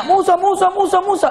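high-pitched, repeated name-calling; insistent mock clamour; pestering questioners. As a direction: imitate a crowd nagging for attention. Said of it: "yang ini nanya".

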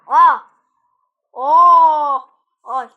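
very loud, drawn-out exclamations; startled yells. Real, not acted. Player reacts to a sudden game event, startled yells. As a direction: burst out with loud startled vowels.

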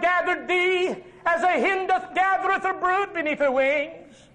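high, impassioned sermon voice; full-force preaching. Acted. Preacher voicing Jesus weeping over the city, full-force preaching. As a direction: cry it out over Jerusalem.

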